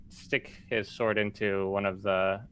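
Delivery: usual speaking voice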